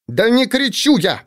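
mother snapping back at her son; exasperated outburst. A narrator's reading. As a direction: cry it out high in frustration. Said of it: "в сердцах выкрикнула Фаина".

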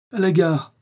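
curt. A narrator's reading. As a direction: cut her off with a dry answer. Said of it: "fit sèchement le pharmacien".

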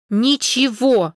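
slow defiant syllables. A narrator's reading. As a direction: spell out the word in emphatic, drawn-out syllables. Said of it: "произнесла по слогам".

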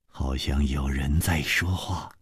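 slow, low near-whisper; soft, tentative, breath-heavy; hushed listening. Acted. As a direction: murmur it slowly while straining to hear. Listening closely at the wall.

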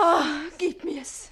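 loud, slow pleading; eager plea. Acted. Page to Susanne for the ribbon, loud eager plea. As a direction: beg for it, desperate.

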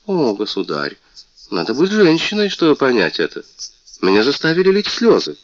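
высокий, звонкий голос обиженной принцессы; жалуйся, как оскорблённая принцесса; мультяшная принцесса. Acted. Принцесса плачется королю. (принцесса жалуется королю, звонко и обиженно)

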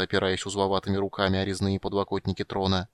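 usual speaking voice

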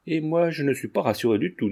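ordinary delivery

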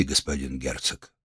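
low, soft doctor voice; calm healer. Acted. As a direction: quietly address the duke as a physician. Healer addresses the duke.

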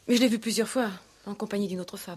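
heated accusation. Acted. Fiancée telling the commissaire what she saw, heated. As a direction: fling it out in jealous anger.

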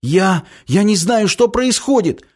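high, distressed stammer; pained panic. Acted. Anton to his brother, pained panicked cry. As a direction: climb in pitch, bewildered and hurting.

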